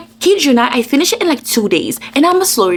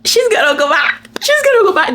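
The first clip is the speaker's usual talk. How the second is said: climbs higher on each repeat; second take much higher and more frantic; rising panic; 9 semitones higher than usual. Retold or imitated. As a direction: spiral up into frantic worry.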